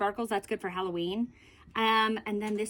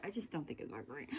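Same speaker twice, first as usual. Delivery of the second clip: much softer, lower aside; under her breath; 6 semitones lower, 15 dB quieter, faster than usual. Real, not acted. Muttering a secret opinion to viewers, very soft and low. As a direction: slip it out under your breath. Half-hidden confession then denial.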